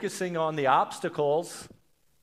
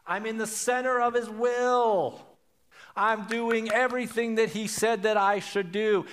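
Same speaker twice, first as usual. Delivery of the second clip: dramatic protest; 6 semitones higher than usual. Acted. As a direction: protest as if unfairly treated by God. Voices the frightened people's complaint to God.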